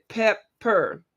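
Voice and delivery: "pepper" slowly as pep, per; syllable by syllable. Shown as a demonstration. Host counting syllables in a guess, "pepper" in two beats. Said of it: "that's two syllables".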